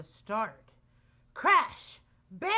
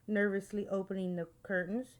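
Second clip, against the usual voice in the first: ordinary delivery, nothing marked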